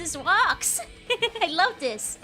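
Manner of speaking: excited. Real, not acted. Delighted at new minions.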